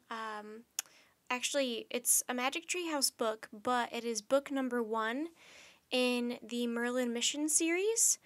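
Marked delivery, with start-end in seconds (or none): none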